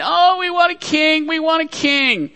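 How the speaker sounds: high-pitched whining plea for a king; mock childish whining at Samuel; whiny people. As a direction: whine it like a spoiled child. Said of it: "and they whined".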